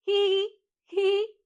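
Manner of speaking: high, loud hee-hee whinny; two loud high neighs for kids to copy; imitación de caballo. Shown as a demonstration. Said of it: "Así hace el caballo". (relincho agudo y fuerte)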